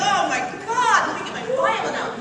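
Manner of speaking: tag. Retold or imitated. Retells own hysterics at overacting.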